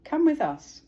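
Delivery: loud high call to the boat. Acted. Duck calling to the others to join. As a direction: call them over brightly. Story character calling out invitation.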